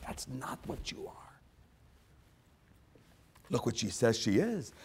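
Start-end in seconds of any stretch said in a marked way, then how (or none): 0.1-1.1 whisper. Real, not acted. Whispered repeat after plain line.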